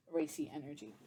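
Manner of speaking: "Racy energy" repeated softly to herself; quiet musing repeat of "Racy energy"; soft echo. Shown as a demonstration.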